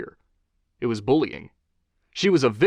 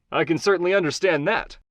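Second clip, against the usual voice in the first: higher, upbeat host voice; talk-show host Johnny; 6 semitones higher than usual. Acted. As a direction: charm the guest as a TV host.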